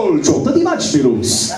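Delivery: long shouted Gol in a Count Chocula voice; soccer announcer vampire. Pretend. Comic showing his accent as a vampire soccer announcer, loud. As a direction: roar the goal call like a cartoon vampire commentator. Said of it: "a soccer game announced by Count Chocula".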